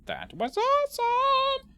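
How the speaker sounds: drawn-out, high, over-the-top cheer; mock excited finish. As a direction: stretch it out in hammed-up excitement. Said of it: "is what she was gonna say".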